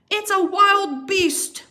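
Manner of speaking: slow, loud hammed-up growl. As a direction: growl it like a startled bear. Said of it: "Papa Bear growled".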